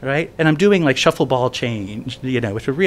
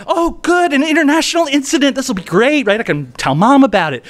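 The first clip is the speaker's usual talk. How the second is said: mock delight; 11 semitones higher, 4 dB louder than usual.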